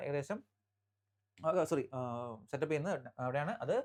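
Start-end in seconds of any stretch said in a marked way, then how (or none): none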